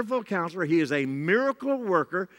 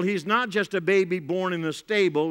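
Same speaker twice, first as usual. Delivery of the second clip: ordinary talk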